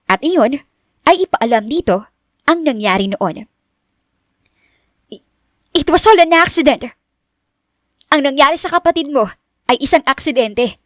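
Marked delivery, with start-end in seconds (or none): 5.1-10.8 high, pleading Richelle voice; anxious to be believed, raised pitch; urgent young woman. Acted. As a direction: blurt the truth out, pitched up. Narrator voices Richelle pleading truth.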